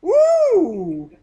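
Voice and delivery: loud, high "Woo!"; gleeful victory cheer; excited whoop. Real, not acted. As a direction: whoop in triumph at a slip-up. Cheering when he says shut up.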